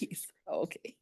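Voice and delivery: quick whispered aside. Real, not acted. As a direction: whisper it off-mic. Chair's quick aside during proceedings.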